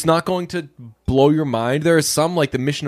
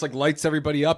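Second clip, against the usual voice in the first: usual speaking voice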